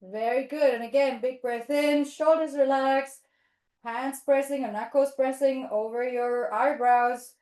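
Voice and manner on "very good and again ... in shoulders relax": ordinary delivery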